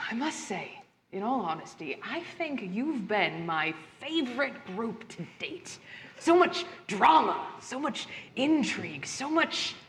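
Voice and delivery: low, soft, theatrical villain voice; a quiet, menacing gracious host; sinister host. Acted.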